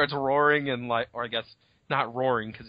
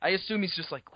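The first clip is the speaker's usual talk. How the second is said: ordinary delivery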